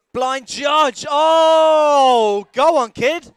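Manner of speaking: high, loud excited yell; excited shout. Real, not acted. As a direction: cheer the rider on at full voice. Commentator cheering a trick.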